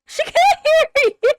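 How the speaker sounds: high, loud shout at a character; yelling at screen. Real, not acted. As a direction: shout it as if they could hear.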